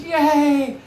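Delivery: put-on high, drawn-out childish cheer; excited kids cheering. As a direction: imitate a gaggle of thrilled kids. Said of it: "again, their response was".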